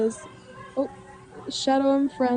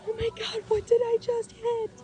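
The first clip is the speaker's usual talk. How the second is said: much higher, panicky wail of shock; retold panicked crying; 12 semitones higher, 3 dB quieter than usual. Driver at night realising she hit something, high panicked cry. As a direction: replay the moment of hitting something, voice pitched way up. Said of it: "I immediately started crying".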